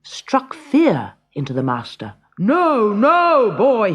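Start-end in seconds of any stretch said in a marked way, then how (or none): 2.4-3.9 louder, higher panicked giant; a giant begging in fright; frightened cry. A narrator's reading. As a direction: cry out in alarm. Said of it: "he cried".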